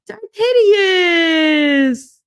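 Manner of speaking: high drawn-out squeal of greeting; gasp, then cry it out long and high; thrilled, stretched-out shout of a name; excited call-out. Real, not acted. Excited greeting to a viewer in chat.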